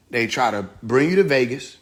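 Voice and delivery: ordinary talk, nothing marked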